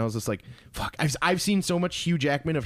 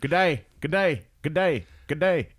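mock Australian accent; 4 semitones higher than usual. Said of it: "his accent".

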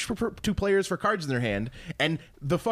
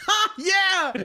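cheering along; 13 semitones higher, 4 dB louder than usual. Retold or imitated. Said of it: "and I'd be like".

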